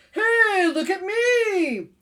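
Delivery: high, loud, drawn-out call; calling for attention. Acted. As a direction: holler like a show-off begging to be noticed. Chameleon calls out for attention.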